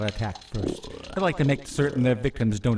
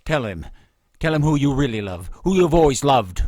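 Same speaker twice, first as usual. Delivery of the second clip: louder, pressing command; menacing demand; 4 semitones higher, 6 dB louder than usual. Acted. Kreia pressing the lady to confess, loud menacing demand. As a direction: force her to say it.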